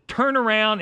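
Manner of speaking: high, loud urgent order; urgent command. Retold or imitated. Telling the driver to turn back, high urgent command. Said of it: "I said".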